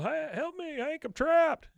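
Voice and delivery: high, loud squeal of a trapped man; Bill's voice. Retold or imitated. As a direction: squeak it out high and loud, pinned under the couch. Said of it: "he goes".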